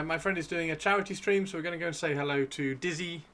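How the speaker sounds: usual speaking voice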